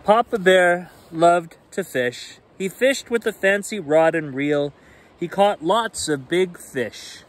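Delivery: lively bedtime read-aloud; animated picture book reading; story narration. A narrator's reading.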